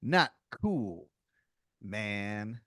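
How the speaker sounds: drawn-out hipster reproach; beatnik intruder. Acted. Intruder rebuked by the player, drawling hipster disappointment. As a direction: stretch each word in cool disapproval.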